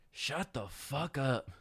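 slow, drawn-out voice of an unseen cellmate; slow imitated command. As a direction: stretch it out like a voice from nowhere.

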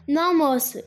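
put-on boy answers the detective with firm certainty; firmly. A narrator's reading. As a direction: state it firmly like a boy sure of himself. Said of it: "disse o garoto com firmeza".

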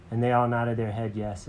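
ordinary delivery

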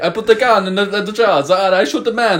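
mock Italian accent; high mocking Italian accent. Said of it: "it's just mimicking".

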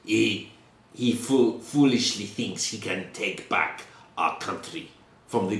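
put-on lightly accented Russian revolutionary, scornful; Russian-accented prisoner. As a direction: sneer at the king, Russian accent. Prisoner mocks the king.

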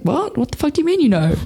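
high-pitched incredulous squawk, retold; stunned disbelief. Stunned reply to mum's reveal.

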